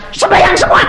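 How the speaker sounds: high, loud command to pray; commanding order. Retold or imitated. Preacher orders crowd to pray. (pendeta memerintah jemaat, tinggi dan keras)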